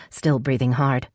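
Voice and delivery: ordinary delivery, nothing marked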